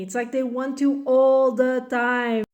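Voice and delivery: usual speaking voice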